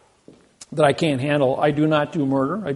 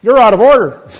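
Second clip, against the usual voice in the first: much louder, much higher, the judge's rebuke; the judge fires back even louder; judge's shout; 8 semitones higher, 17 dB louder than usual. Retold or imitated. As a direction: judge topping the witness, loud and high. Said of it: "Then the judge perks up and says".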